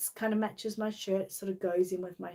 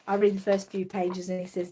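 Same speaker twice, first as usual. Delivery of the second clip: ordinary talk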